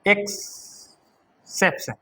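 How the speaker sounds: drawn-out exception, each syllable stretched for the class; slow spelled-out word. Shown as a demonstration. Teacher to class, dictating slowly. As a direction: say exception very slowly so students copy it. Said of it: "exception कह सकते हैं".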